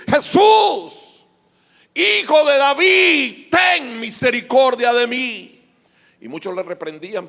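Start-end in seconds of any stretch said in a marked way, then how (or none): 0.1-5.6 loud, high drawn-out plea; crying out. Acted. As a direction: shout it slowly as a desperate call for mercy. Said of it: "comenzó a dar voces y a decir".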